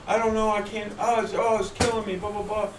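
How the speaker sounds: high, whiny cry for help in a joke; whining pained partner. Retold or imitated. Joke teller voicing the hurting partner, high and whiny. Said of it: "He runs over and says".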